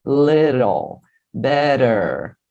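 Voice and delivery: slow, low "Little, better" with tapped R for each T; T turned to R. Shown as a demonstration. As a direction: draw out "le-rol, be-rer". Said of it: "ele vai virar R". ("Little, better" devagar, com o T virando R)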